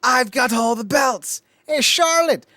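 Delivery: high, loud, drawn-out put-on voice; mock wrestler taunt. Wrestlers trading jabs over belts, mock taunt. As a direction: crow it out like a hammy challenger.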